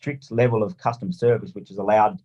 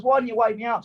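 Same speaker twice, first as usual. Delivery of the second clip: mock yell; 10 semitones higher than usual. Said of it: "yell at the missus".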